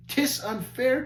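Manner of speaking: high, aggrieved protest; complaining. Acted.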